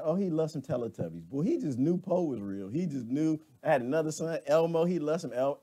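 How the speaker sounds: usual speaking voice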